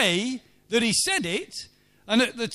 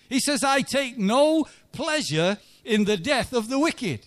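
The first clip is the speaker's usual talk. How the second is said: rising into full preaching, higher and louder; building sermon; 5 semitones higher, 4 dB louder than usual. Acted. A preacher urging the congregation, climbing to full force. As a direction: press the point home to the congregation.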